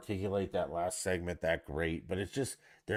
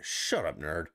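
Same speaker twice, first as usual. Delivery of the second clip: ordinary talk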